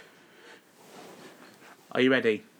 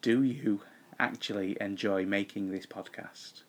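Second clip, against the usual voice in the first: slowed down; 3 semitones lower, 5 dB quieter than usual. Shown as a demonstration.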